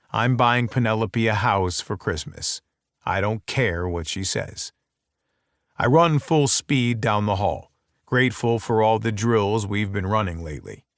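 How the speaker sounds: plain reading voice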